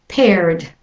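Paired with an American R before the D; American accent: keep the R in Paired, then D. Shown as a demonstration. Teacher models American paired. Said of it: "for those practicing an American accent".

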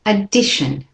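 "Addition" said clearly with schwa start and shun end; model word. Shown as a demonstration. Teacher gives model for class to repeat, clear shun. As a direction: say "Addition" for them to copy. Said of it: "Say it with me".

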